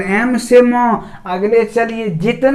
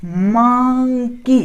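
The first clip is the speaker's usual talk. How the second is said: drawn-out slow monkey with the म held; slow demo; much slower than usual. Shown as a demonstration. Teacher slowly models monkey for the class. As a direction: stretch monkey out slowly to show the m. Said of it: "यहाँ पर हम देख रहे हैं कि ये जो म है".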